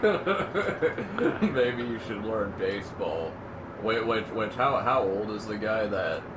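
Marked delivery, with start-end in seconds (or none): 0.0-3.3 slow, amused parroting; laughing mockery. Co-host echoing a online critic's jibe, laughing mockery. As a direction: repeat the jibe slowly through laughter.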